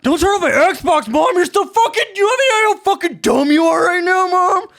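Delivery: very high, whiny screeching tantrum; asshole kid yelling. Acted. Gamer kid yelling at his mom over the Xbox, shrieking tantrum. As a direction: screech like a bratty kid at the top of your range. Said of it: "asshole kids yelling at his mom".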